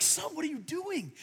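high, startled dad shouting at his son; alarmed shock. Retold or imitated. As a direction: catch him mid-throw, half panicked.

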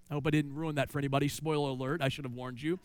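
quick, dropped-voice teasing aside; joking aside. Real, not acted. Pastor teasing the congregation about spoiling the clown, mock-apologetic aside.

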